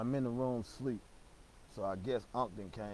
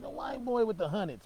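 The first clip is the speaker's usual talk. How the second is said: insistent; 11 semitones higher, 6 dB louder than usual. Retold or imitated. Insisting to his cousin.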